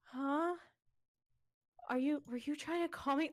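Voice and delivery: groggy, just-woken voice; tired voice. Retold or imitated. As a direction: sound half asleep and confused. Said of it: "with a tired voice".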